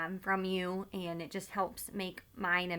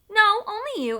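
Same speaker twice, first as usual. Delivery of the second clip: put-on pert girl reply; 13 semitones higher, 9 dB louder than usual. A narrator's reading. Girl answers soldier defiantly.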